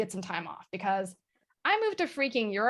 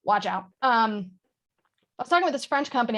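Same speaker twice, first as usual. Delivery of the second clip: ordinary delivery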